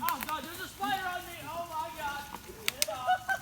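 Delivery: panicked yelp, high at the end; freaking out over a spider; screaming at a spider. Real, not acted.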